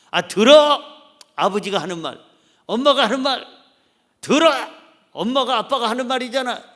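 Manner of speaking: loud, commanding shout of listen; a parent barking at the family to listen; bossy parent yelling. Acted. 가족에게 들으라고 호통치는 부모, 크게 외침.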